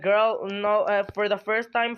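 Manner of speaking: ordinary delivery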